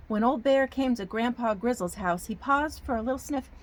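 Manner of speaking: put-on storybook narration in a folksy Southern accent; hammy Southern storytelling accent. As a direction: read it like a Southern yarn for children. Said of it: "I get a Southern accent".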